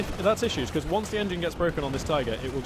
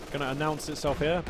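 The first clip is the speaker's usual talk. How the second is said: usual speaking voice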